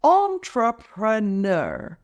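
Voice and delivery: slow, syllable-by-syllable "Entrepreneur"; "Entrepreneur" taken apart slowly for learners; broken into syllables. Shown as a demonstration. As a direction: stretch "Entrepreneur" out, each syllable on its own. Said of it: "Let's break it down".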